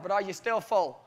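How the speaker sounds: normal talking voice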